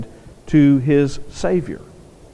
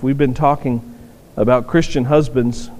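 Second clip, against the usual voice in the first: ordinary delivery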